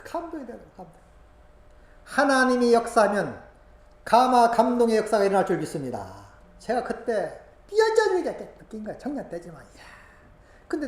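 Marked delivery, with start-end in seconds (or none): 2.1-6.2 loud sermon proclamation; preaching. Real, not acted. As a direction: declare it with faith.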